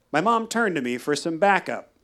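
ordinary talk, nothing marked

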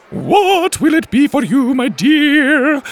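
high, loud operatic cook. Acted. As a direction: sing the question like an opera singer. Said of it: "came an operatic voice".

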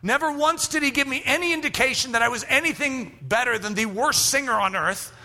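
normal talking voice

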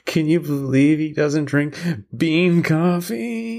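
high, slow exaggerated wail; mock crying. Teasing about the tearful coffee episode, mock crying. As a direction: bawl it in fake outrage. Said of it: "start crying".

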